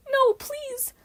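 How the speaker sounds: high, pleading yelp. A narrator's reading. Hazel begging the bully not to touch it, high yelp. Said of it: "Hazel yelped".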